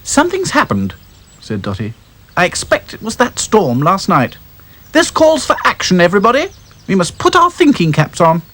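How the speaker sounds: high, brisk leader doll; rally the troops; bossy Dotty. Acted. Dotty calling the dolls to action, brisk.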